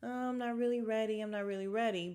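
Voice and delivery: fast, lightly put-on whiny "not ready"; mock her stalling excuse; mimicking Sheree. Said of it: "she just kept saying".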